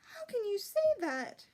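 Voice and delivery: high, loud, tearful outburst; flaring up. A narrator's reading. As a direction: snap back through tears. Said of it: "flared the ordinary princess".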